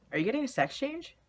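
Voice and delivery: surprised mom. Retold or imitated. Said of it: "my mother was like".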